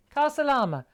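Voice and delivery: high, loud lilting call; re-enacted cheery call; retold farewell. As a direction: call out the foreign phrase, falling at the end. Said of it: "when I said to them".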